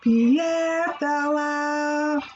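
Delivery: slow, lilting read-aloud voice; storybook reading. Acted. Teacher reading a rhyming story to children. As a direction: read it gently to little kids.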